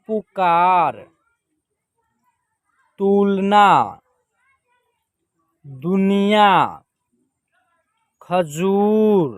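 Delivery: slow word list, long vowels held, pauses between words; teacher sounding out single words; drawn-out model reading. Shown as a demonstration. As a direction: stretch each long vowel.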